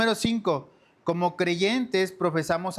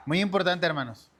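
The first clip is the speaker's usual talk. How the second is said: normal talking voice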